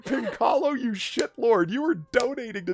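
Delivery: high, delighted mock scolding; laughing disbelief. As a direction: tease a friend through laughter. Delighted, laughing retold reaction to donation.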